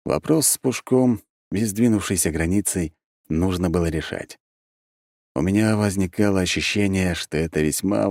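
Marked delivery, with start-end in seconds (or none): none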